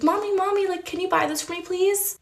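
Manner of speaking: high, wheedling little-kid voice, put on; pleading little girl. Retold child begging her mother.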